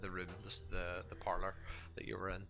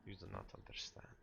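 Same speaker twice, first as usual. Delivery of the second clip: lightly voiced resigned sister, dropping softer; dismissive game character; 9 dB quieter than usual. Sister answers newcomers who do not understand, mild dismissal. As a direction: let the words fall away in mild dismissal.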